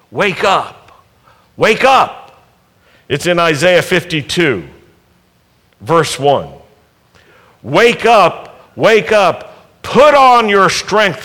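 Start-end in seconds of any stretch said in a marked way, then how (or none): 0.1-2.4 calling out. Acted. God calling Uri to wake. 7.6-11.2 fervent call. Acted. Quoting Isaiah with fervour.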